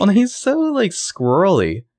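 squealing at how unsubtle he is; amused exclamation. Real, not acted. Hosts mocking Johnny's clumsy sneaking, excited.

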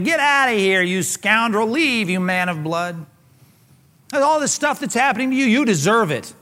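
acted angry taunting; hurled abuse. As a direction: yell it like you're driving someone out of town. Shimei hurling abuse at David.

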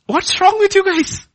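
loud, very high exasperated outcry; outraged rebuke. Retold or imitated. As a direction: cry out in acted indignation. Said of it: "Nehemiah is asking".

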